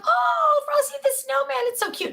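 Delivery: put-on grandma cooing at the boys' Frosty; gushing over cute. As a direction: coo at the snowman like it's adorable. Adoring the inflatable snowman.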